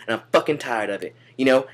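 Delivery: ordinary talk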